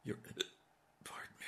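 breathy hiccuping whisper; catching hiccups between words. Real, not acted. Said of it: "I have the hiccups suddenly".

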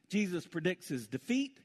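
normal talking voice

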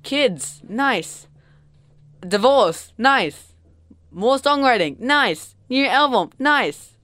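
high, loud punchy exclamations, each one falling; mock upbeat checklist. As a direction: tick off life events like triumphs. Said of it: "there was just like".

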